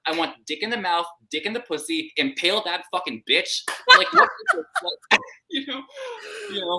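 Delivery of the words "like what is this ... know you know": high squeaky laugh through the words; laughing squeal. Real, not acted. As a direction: squeal it while cracking up. Mock outrage, laughing.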